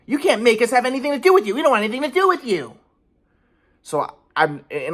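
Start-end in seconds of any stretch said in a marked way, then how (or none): 0.0-2.8 high, cold mocking parents. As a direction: mimic parents shutting him out, rising. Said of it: "they're like".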